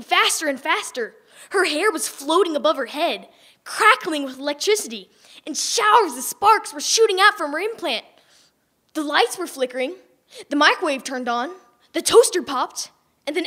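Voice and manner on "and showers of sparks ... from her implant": usual speaking voice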